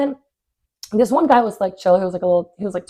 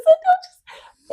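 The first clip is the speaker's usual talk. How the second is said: squeal; 5 dB louder, much slower than usual. Real, not acted. Mid-story exclamation.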